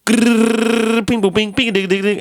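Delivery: high, playful mouth-sound beat; squeaky sound effects imitating music software; imitating beat noises. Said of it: "He's like".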